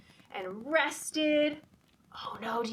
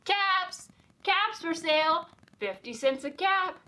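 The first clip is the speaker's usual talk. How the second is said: calling out wares; 6 semitones higher than usual. A narrator's reading. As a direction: sing out like a peddler selling caps.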